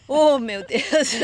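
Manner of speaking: loud, drawn-out laughing exclamation; big amused exasperation, breaking into laughs. Real, not acted. Both laughing about marrying Englishmen.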